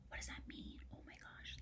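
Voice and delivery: tiny breathless whisper; awed whisper. Real, not acted. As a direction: hush in amazement. Amazed aside to children.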